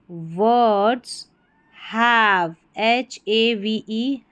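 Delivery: ordinary talk, nothing marked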